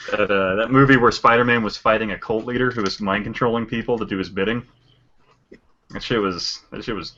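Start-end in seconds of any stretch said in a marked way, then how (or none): 5.9-7.1 deadpan, drawn-out praise; dry, ambiguous sarcasm; possibly sarcastic. Real, not acted. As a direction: praise it slowly and softly, barely holding it together. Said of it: "can't tell if you're being sarcastic".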